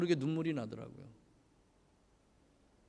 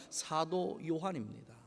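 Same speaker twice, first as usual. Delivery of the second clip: usual speaking voice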